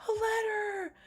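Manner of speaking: high gasp, then a squeal of discovery; surprised gasp. Real, not acted. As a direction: gasp at the find. Sees the letter, gasps.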